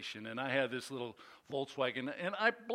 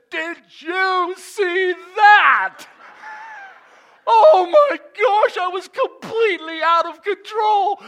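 high, loud, slow astonished yell; shocked shout; 14 semitones higher, 16 dB louder, slower than usual. Retold or imitated. Teen after the spin, shouting to the cop in shock. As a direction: shout it in disbelief.

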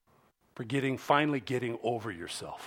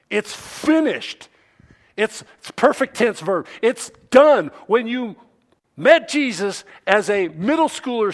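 raised-voice sermon delivery with weighty pauses; emphatic preaching; 10 semitones higher, 11 dB louder than usual. Real, not acted. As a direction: preach it forcefully, hammering each word. Preaching at full force.

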